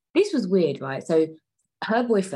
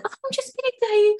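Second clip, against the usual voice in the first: very high, louder mock-innocent voice; put-on girlfriend voice; 5 dB louder than usual. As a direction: squeak out the excuse as the girlfriend. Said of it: "And she's like".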